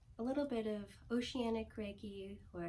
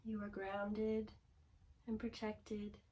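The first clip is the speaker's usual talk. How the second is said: guided meditation. Acted. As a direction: guide them gently into calm, phrase by phrase.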